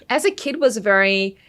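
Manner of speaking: usual speaking voice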